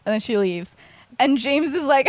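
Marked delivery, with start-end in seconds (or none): none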